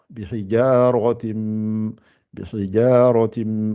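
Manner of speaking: bihijaratim with a full, hollowed ra; slow bihijaratim, ra rounded and heavy. Shown as a demonstration. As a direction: swell the ra in bihijaratim. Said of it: "essaie de bien gonfler le ra".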